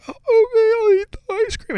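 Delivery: put-on very high, wailing child giving in; squeaky fake-crying kid voice; imitated crying. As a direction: give in through mock sobs. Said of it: "it made me cry even more".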